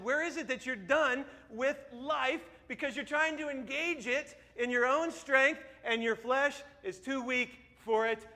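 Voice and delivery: ordinary talk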